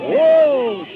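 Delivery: loud, high startled cry; startled whoa. Real, not acted. [name] startled by the duck's commotion, loud high whoa. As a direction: jump at the surprise.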